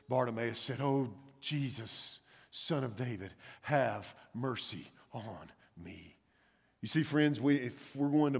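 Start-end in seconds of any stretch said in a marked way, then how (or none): 0.8-6.2 slow, drawn-out, subdued begging; broken, desperate plea. Acted. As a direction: plead softly from brokenness, word by word. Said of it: "in desperation and brokenness".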